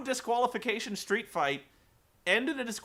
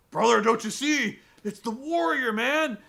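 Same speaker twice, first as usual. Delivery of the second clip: higher, louder raspy wrestler voice; Hulk Hogan impression; 5 semitones higher, 6 dB louder than usual. A haunted wrestler pleading with his boss, hammed-up wrestler voice. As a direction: ham up a frantic wrestling star. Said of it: "And Hulk's like".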